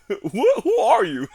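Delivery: high, loud words through laughter; giggly, incredulous mock question; laughing retort. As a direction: crack up while mock-asking.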